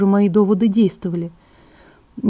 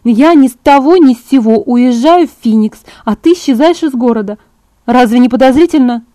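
higher, louder, insistent argument; lightly voiced Bella, indignant; 5 semitones higher, 8 dB louder than usual. A narrator's reading. Bella argues that the plan looks suspicious, indignant. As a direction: press the objection with rising emphasis.

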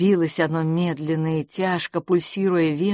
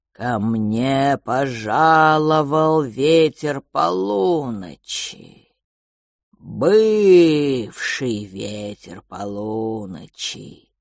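lower, slow, drawn-out creature voice; slow cold whisper; 4 semitones lower, 3 dB louder, much slower than usual. Acted. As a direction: stretch each word like resin. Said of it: "Он говорил медленно и тихо, растягивая слова". (медленный холодный шёпот)

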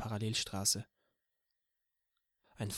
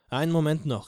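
called out; 4 semitones higher, 6 dB louder than usual. Acted. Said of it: "rief Eugene".

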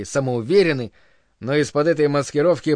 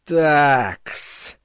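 snaky drawn-out s. A narrator's reading. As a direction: hiss out the s like a snake. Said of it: "змеино протянул звук «С»".

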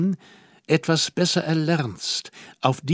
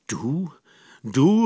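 deep astonishment. A narrator's reading. Man replies to Hermine's confession, deeply astonished. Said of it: "rief ich tief verwundert".

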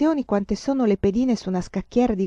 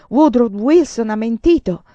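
loud, bitter accusation full of despair; hateful outcry; 5 semitones higher, 9 dB louder than usual. A narrator's reading. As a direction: hurl the accusation, choking with hatred. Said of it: "La sua disperazione era carica d'odio".

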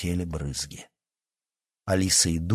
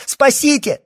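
very high, loud call for rescue; drowning cry for help; panicked scream; 8 dB louder, slower than usual. A narrator's reading. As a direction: cry out in desperate terror. Drowning girl calls for rescue.

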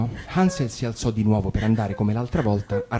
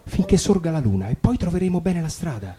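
a big brother soothing, a bit higher; gentle comforting; 8 semitones higher than usual. A narrator's reading. Hansel comforting his crying sister, gently. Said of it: "Ma Hansel la consolò".

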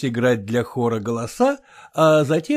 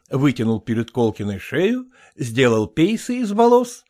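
plain reading voice

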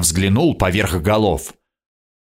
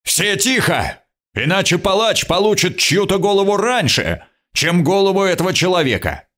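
high-pitched commanding call; raised, forceful ruler's command; powerful bellow; 7 semitones higher than usual. A narrator's reading. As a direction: order the crowd silent with a threat. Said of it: "гаркнул мощно".

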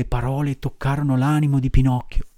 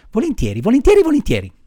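mounting eager assent, leaping high and louder; eager agreement; 10 semitones higher, 5 dB louder, faster than usual. Acted. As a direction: repeat it with rising enthusiasm. Puppet eagerly agrees to obey. (assenso entusiasta, sempre più acuto)

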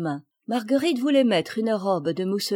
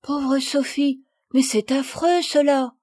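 put-on high, emphatic childlike indignation; appalled little girl; 6 semitones higher, 5 dB louder, slower than usual. Marguerite reacts to Sophie's drinking ban, appalled. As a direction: draw out the pity, then protest loudly.

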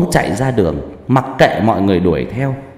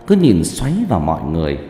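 ordinary delivery, nothing marked

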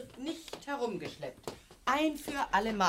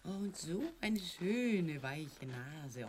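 slow, soft and low admiring; tender; 6 semitones lower, 6 dB quieter, much slower than usual. Acted. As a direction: croon over the soft nose. Mutter streichelt das Einhorn. (zärtlich und langsam)

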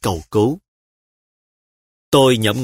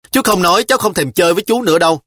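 put-on higher, fast child's sulky threat; a little girl threatening to stop playing; 8 semitones higher, 4 dB louder, faster than usual. A narrator's reading. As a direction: huff it over your shoulder. Child threatens while running.

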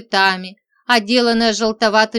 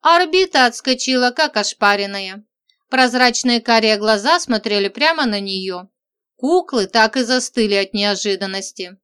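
plain reading voice

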